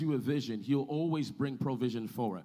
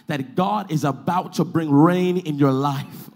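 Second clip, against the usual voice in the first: slow, loud proclamation; forceful preaching; 9 dB louder than usual. Real, not acted. Preacher promising rain to congregation, slow forceful proclamation. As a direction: declare it slowly and powerfully.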